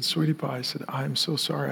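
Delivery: a father apologizing through tears; soft teary whisper-like sorry; tearful apology. Retold or imitated. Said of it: "I just started crying".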